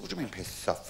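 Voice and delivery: low, hushed acted protest; quietly put-out customer; soft affronted query. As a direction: question the refusal softly. Customer queries being refused.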